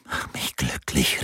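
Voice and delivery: slow breathless whisper. A narrator's reading. Laura to Rinaldo in bed, a slow whisper. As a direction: breathe it out, barely voiced. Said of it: "flüsterte sie".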